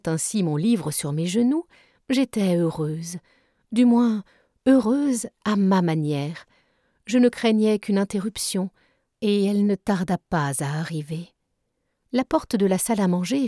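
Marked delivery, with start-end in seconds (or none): none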